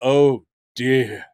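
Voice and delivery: drawn-out, theatrical lament; weary, hammy dismay; Jonathan Harris. Retold or imitated. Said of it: "Jonathan Harris in a rakish".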